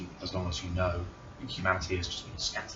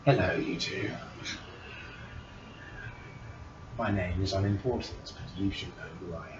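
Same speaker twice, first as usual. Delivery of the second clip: evil queen; 4 semitones higher, 16 dB louder than usual. Acted. GM voices divine being.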